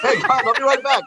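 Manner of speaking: laughing pretend goodbye; laughing mock exit. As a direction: fake a breezy exit while cracking up. Imagined escape line, laughing.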